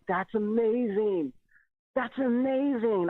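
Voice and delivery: overwhelmed by what he saw; slow awestruck that's amazing; amazed delight. Retold or imitated. Overjoyed at lights.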